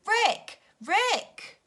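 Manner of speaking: high, loud shouts of a name; shouting. A narrator's reading. Everyone shouting up the ladder for Rick, loud. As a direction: yell up the ladder. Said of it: "Everyone began to shout".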